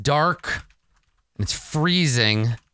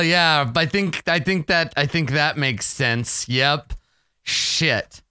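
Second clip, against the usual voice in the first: ordinary talk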